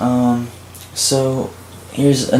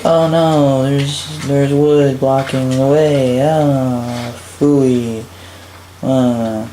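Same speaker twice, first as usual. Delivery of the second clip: higher, drawn-out mock despair; mock dismay; 3 semitones higher, much slower than usual. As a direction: play a stumped player lamenting in jest. Player finds the way blocked.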